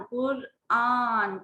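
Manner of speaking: कुरआन stretched very slowly, कुर then आन pulled apart; slow teaching demo of Quran, syllable by syllable; drawn out syllables. Shown as a demonstration. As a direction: say Quran slowly, letting each part ring. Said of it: "वो अलग से पढ़ा जाता है".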